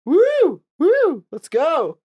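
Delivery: high, loud whooping cheer; mock cheering yell. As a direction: whoop like a guy cheering a jogger. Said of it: "This is what I did".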